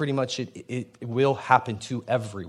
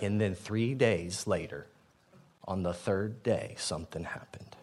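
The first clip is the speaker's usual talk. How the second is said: hushed suspense; 5 semitones lower, 10 dB quieter than usual. Acted. Dramatic setup of resurrection.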